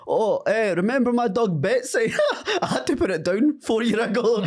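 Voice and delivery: high-pitched, awkward mimicry breaking into laughter; socially awkward acquaintance. Awkward schoolmate blurting about his dog to David, high-pitched mimicry. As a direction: imitate an awkward old schoolmate, pitched well up. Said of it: "a bit socially awkward".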